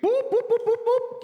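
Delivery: high loud whooping police siren imitation; mock siren boops; siren noise. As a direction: mimic the cop car's siren, high and loud.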